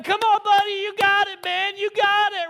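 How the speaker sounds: loud, high crowd cheer. Retold or imitated. Family cheering the boy up the wall, loud encouragement. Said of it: "all of us saying".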